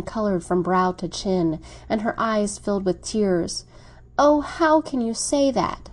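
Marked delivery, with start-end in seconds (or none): none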